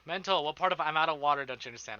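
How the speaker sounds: ordinary talk, nothing marked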